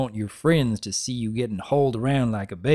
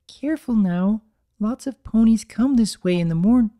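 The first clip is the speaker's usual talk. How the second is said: gentle Fluttershy; 9 semitones higher, 4 dB louder than usual. Acted. Fluttershy guiding ducklings, higher gentle mare voice.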